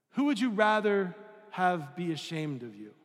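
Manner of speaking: ordinary delivery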